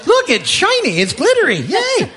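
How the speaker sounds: mock excitement. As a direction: gush over the foil like a kid, jokingly. Mocking foil-wrapped present joke.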